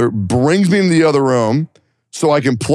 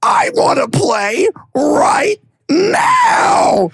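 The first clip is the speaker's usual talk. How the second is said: high, drawn-out bratty demand; mock yell of a sibling insisting on his turn; middle brother; much slower than usual. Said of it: "my middle brother was like".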